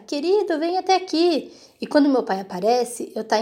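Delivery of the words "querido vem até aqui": mother calling her husband from the bathroom, pitched-up mock yell; mock shout. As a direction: call for the husband, pitched up high. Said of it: "E ela começa a gritar".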